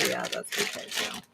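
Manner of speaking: low unvoiced whispered aside. Real, not acted. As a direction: murmur it under your breath as a whisper. Checking palette, whispered aside.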